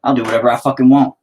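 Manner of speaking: ordinary delivery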